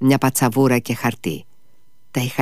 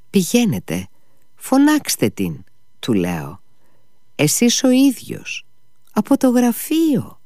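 urging; 11 semitones higher than usual. Acted. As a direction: push him to go call her yourself. Urging master to call her.

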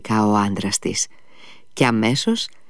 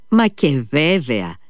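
ready agreement; 4 semitones higher, slower than usual. A narrator's reading. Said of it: "συμφώνησε αμέσως".

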